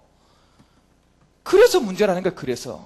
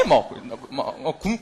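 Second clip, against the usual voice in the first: ordinary talk, nothing marked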